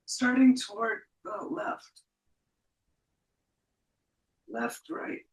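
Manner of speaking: strained, dusty throat; forcing words past dust; coughing on dust. Real, not acted. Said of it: "Got some dust in my throat".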